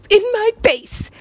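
high-pitched emphatic burst; excited exclamation. Retold or imitated. Retelling bass drop, emphatic repeat.

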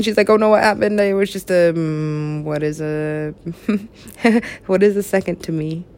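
ordinary delivery

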